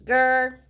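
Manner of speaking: loud playful growl; tiger growl. Acted. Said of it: "a bit more grrr".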